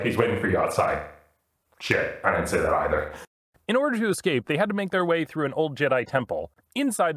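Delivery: ordinary talk, nothing marked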